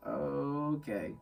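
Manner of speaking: soft, drawn-out reluctant okay; uneasy resignation. Retold or imitated. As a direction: trail it out quietly, unconvinced.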